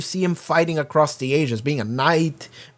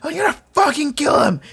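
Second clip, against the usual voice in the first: high-pitched imitated war cry; mock screaming; 10 semitones higher than usual. Immortal flying off after Omni-Man, mock screaming. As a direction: yell the threat as a revived warrior. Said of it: "just screaming".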